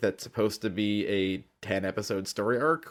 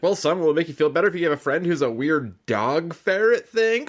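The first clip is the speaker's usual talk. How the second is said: lightly acted dad pitching an idea, raised pitch; coaxing dad; 7 semitones higher, 4 dB louder than usual. Dad offers upset son a strange pet, lightly voiced coaxing. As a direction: sell it to your son. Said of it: "And he's like".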